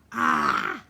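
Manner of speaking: loud gasping yell as cold water hits; shocked cry. Acted. Solly yelling as water is thrown on him. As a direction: cry out from the drenching. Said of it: "Solly Miller cried".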